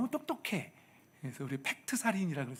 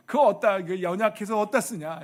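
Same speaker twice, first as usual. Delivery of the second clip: louder, higher scornful scoff; loud mock scoff; 5 semitones higher, 10 dB louder than usual. Husband mocking thin people to flatter his wife, loud scoff. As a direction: sneer it out for laughs.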